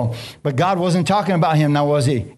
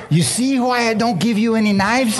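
mom's scolding; 8 semitones higher than usual. Retold or imitated. Mom scolding him in story.

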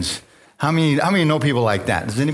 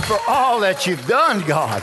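fervent praise; 5 semitones higher, slower than usual. Acted. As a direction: lift the room with praise, pitch high. Preacher warming up the church.

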